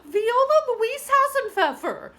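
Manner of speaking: high, loud scolding call of her name; stern calling out. Acted. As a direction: call her out across the room. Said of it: "called her teacher".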